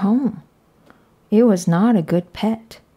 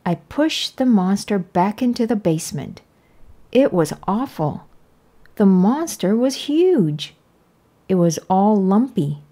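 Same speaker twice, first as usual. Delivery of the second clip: plain reading voice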